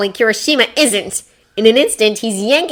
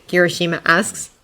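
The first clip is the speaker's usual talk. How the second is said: ordinary delivery, nothing marked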